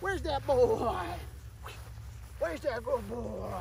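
soft, slow coaxing; coaxing coo. Real, not acted. Trainer calling puppy back, soft coaxing. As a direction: gently call the puppy back.

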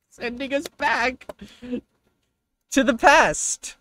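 mock dramatic narration. Mock dramatic trailer ending.

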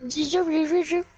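put-on playful try at a Russian accent; attempted Russian accent. Trying out an accent on friends, mock Russian accent. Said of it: "I did a Russian accent".

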